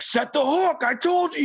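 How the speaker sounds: high, impatient mock of the gruff fishing mentor; imitating Skip. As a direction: bark it like a frustrated captain at a kid. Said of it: "in his voice".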